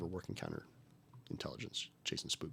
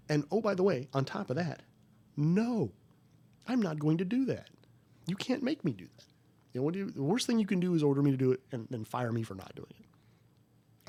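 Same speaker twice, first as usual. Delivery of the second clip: higher, louder mock defiance; defiant retold refusal; 5 semitones higher, 4 dB louder than usual. Refusing the order to his bosses, raised and defiant. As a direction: dig in and refuse, half-performed.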